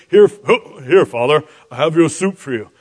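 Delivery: son posing as his brother; hammed-up impostor addressing father; fake manly voice. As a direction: put on a clumsy disguise voice. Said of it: "manly voice like Esau would".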